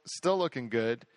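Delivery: normal talking voice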